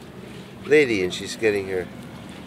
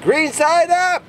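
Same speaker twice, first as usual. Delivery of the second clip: high, slow drawn-out call; yelling out window; 6 dB louder, much slower than usual. Retold or imitated. Contractor yelling to sod layers outside, long high shout. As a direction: call it long across the yard.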